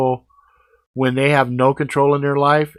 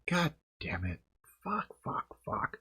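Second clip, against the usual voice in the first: much softer, under the breath cursing; muttered curses; 7 semitones higher, 14 dB quieter than usual. Retold or imitated. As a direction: swear quietly to yourself after she hangs up. Muttering after hang-up.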